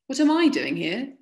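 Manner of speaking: mildly outraged retort, voice lifting; indignant echo. A narrator's reading. Indignant echo of soldier's question.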